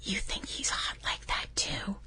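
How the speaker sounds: the voice in the back of her head teasing her, whispered; whispered inner voice. Retold or imitated. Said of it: "this little voice in the back of my head".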